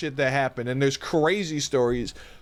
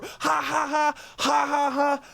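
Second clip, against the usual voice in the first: high, breathless ha-ha laughter, much higher; reenacted laughing fit; 9 semitones higher, slower than usual. Stoned kid laughing in a stranger's face. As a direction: cackle it out like you can't stop. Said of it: "start fucking laughing in her face".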